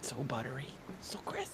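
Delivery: hushed, voiceless savouring; awed whisper. Real, not acted. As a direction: whisper it as if relishing every detail. Savouring the image resolution.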